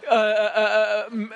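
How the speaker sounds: usual speaking voice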